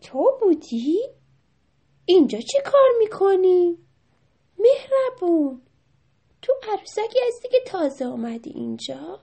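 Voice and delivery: high, loud questioning mouse; inquisitive mouse character. A narrator's reading. The mouse meets the new doll, high inquisitive voice. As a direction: voice a surprised storybook mouse.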